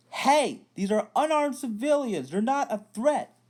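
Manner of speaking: high, loud pleading shout; Finn yells. Retold or imitated. Finn yelling at fellow troopers to stop attacking civilians. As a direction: yell to stop the beating. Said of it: "Then Finn starts yelling".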